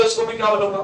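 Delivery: usual speaking voice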